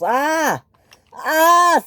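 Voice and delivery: two high cries; alarmed scream. A narrator's reading. Percy hanging on after the crash, alarmed scream.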